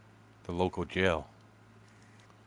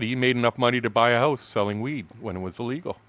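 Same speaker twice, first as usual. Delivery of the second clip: ordinary talk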